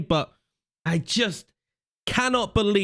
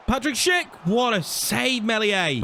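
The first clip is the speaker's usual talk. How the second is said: excited call; 7 semitones higher than usual. Real, not acted. Shot saved in game.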